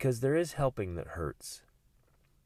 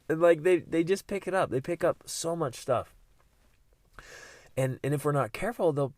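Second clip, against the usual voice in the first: ordinary delivery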